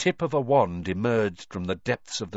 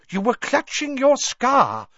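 eccentric seer voice; 6 dB louder than usual. A narrator's reading.

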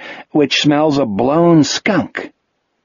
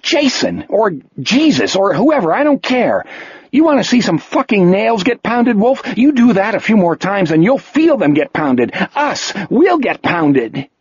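angry tirade; 7 semitones higher than usual. A narrator's reading. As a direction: tear into him, voice rising higher. Jack berating Wolf in anger.

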